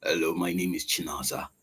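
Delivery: very low, hushed imitation of a woman; imitating lady's voice. Lady introducing herself in a surprisingly deep voice, imitated low. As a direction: drop it deep and soft, imitating her. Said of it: "I was stunned because the, the, the lady was".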